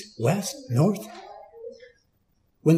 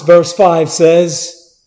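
usual speaking voice

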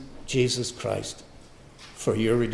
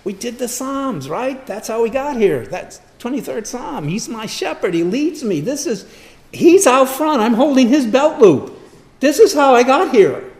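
high-pitched, building to a loud shout; impassioned preaching; 12 semitones higher, 11 dB louder than usual. Real, not acted. Preacher testifying how the shepherd leads him, impassioned preaching. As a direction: surge into full-force testimony. Preaching at rising full force.

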